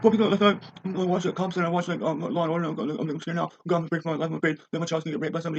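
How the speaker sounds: high, whiny put-on fearful voice, fast at the end; mocking scared kid. Ranter mocks a frightened TV viewer in a high whine. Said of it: "who are like".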